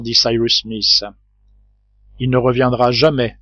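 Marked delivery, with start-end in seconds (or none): none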